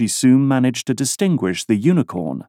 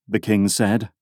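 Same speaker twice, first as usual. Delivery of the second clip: ordinary delivery, nothing marked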